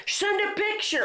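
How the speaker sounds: very high-pitched mock yell; Donnie on the phone. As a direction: imitate an excited friend hollering down the phone. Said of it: "you could hear him".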